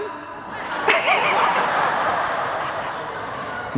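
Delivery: high airy wheeze of a laugh; breathless laugh. Real, not acted. Preacher cracks up at his own joke, airy wheezing laugh. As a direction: let out a silent, breathy laugh.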